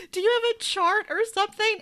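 high, quick, puzzled voice; high confused voice. Retold or imitated. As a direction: ask like a lost girl. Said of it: "She's like".

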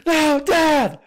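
high, loud frantic cry with a laugh breaking in; panicked yell. Retold or imitated. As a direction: shout at dad in alarm. Kid panics over hurt dad.

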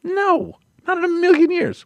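high, adamant no; refusing to pierce his nipples, high and adamant; emphatic refusal. Real, not acted. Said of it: "refusing the piercing".

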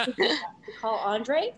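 imitating a friendly car assistant, rising at the end; lightly voiced, friendly in-car helper. Car's lady offers to place a call, friendly. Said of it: "She's so friendly".

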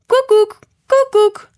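loud, very high two-note cuckoo; cuckoo clock bird. A narrator's reading. Bird popping out of the clock, high cuckoo call.

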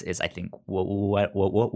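ordinary talk, nothing marked

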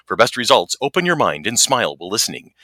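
disclaimer read like an ad; deadpan product warning label voice; fast legal disclaimer. Acted. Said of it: "mock product disclaimer read".

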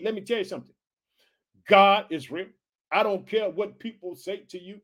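normal talking voice

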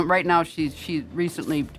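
usual speaking voice

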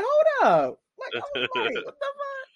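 very high, shrieking mock shock at herself; shocked squeal. Comparing herself in the mirror, high shrieking mock shock. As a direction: shriek in comic horror at the mirror. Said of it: "I looked at myself in the mirror like".

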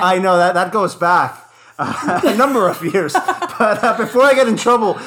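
chuckling through the words; laughing. Real, not acted. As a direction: talk while still laughing. Laughing after deep cut joke.

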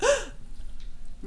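sharp airy intake of breath; startled gasp. Acted. Game master as Jenks hearing Blackstaff, awed gasp. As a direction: mimic a small awestruck gasp. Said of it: "He's like".

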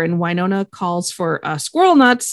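usual speaking voice

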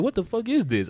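high, startled exclamation; jolted awake in disbelief; shocked. Retold or imitated. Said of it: "I was like".

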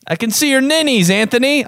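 high mock grandma voice; piping up like an old grandma calling out; grandma by the pool. Grandma spotting him by the pool, mock high voice. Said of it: "My grandma used to call nipples ninnies".

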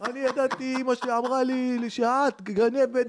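put-on betrayed boyfriend cursing the girlfriend, loud and high; angry insult. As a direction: spit the insult at her.

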